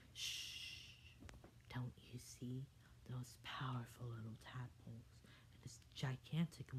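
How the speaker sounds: hushed, soft whisper; fairy whispering so the tadpoles won't hear. Acted. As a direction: shush him and whisper urgently. Fair Fairy shushes Downward Dog.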